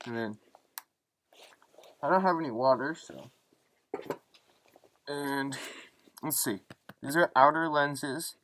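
muffled behind mask voicemitter; speaking inside sealed respirator; through a gas mask. Real, not acted.

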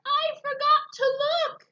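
high loud yell. Acted. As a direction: call out in a panic. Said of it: "yelled the spider".

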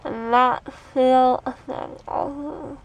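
talking while touching numb lip; fingers on lips. Real, not acted. Seen 1: Fingers press and pull lower lip throughout; absent in R frames.

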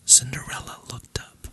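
plain reading voice

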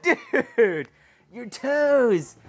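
high excited voice; excited dog talk. Real, not acted. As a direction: gush at the dog.

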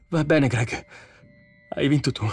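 near tears. A narrator's reading. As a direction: concede weakly, on the verge of tears. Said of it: "disse con un filo di voce, sull'orlo delle lacrime". (un filo di voce, quasi in lacrime)

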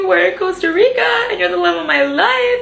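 high, slow, choked-up gushing; teary gushing. Retold or imitated. Tearfully telling Chris how lucky they are, high and slow. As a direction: gush through happy tears. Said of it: "every time I would talk, I would just start crying".

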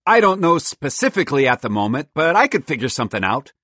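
high, loud squirrel voice; defensive cartoon squirrel, loud; excitable squirrel, defensive.

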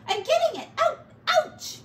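high, loud pained squeals; pained yelps. Acted. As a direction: yelp each word as thorns stab. Pack Rat pricked by cactus.

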